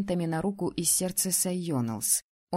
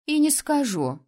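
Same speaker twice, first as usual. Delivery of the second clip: higher, louder playful refusal, stress on не; teasing; 7 semitones higher, 5 dB louder than usual. A narrator's reading. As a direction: tease like sticking out your tongue. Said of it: "не сдержавшись, показала язык".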